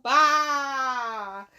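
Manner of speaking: normal talking voice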